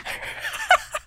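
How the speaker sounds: high squealing burst of laughter. Real, not acted. Big laugh at joke.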